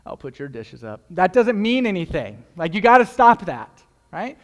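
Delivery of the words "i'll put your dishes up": suggestive husband. Retold or imitated. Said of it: "It's like".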